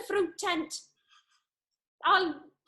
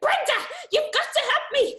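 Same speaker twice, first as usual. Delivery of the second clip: higher, louder desperate plea while shaking her; desperate pleading; 7 semitones higher, 4 dB louder than usual. Acted. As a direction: plead frantically, almost panicking.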